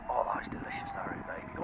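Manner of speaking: soft whisper over a tasty treat; whispered delight. Acted. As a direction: murmur it like a secret pleasure. Tasting cronut samples.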